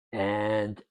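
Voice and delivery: ordinary talk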